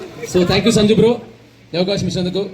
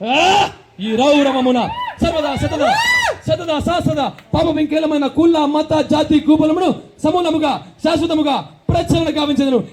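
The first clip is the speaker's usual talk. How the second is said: grandson's grand dialogue war. Acted. Grandson lectures grandpa in a grand dialogue war, declaiming. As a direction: roll out each line like a mythological hero on stage. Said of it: "మనవడు ఒక dialogue వెళ్తాడు తాతకు".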